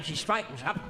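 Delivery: ordinary delivery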